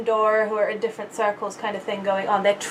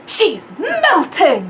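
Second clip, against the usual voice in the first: drawn-out, higher, overdramatic cry; hammed-up reading; 6 semitones higher, 5 dB louder, much slower than usual. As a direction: wail it melodramatically, very slowly. Script line, hammed up.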